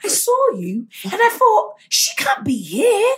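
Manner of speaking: high, breathless gushing fan; lightly voiced fan gushing; excited fan. Imitating a fan explaining how she spotted her. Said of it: "she was like".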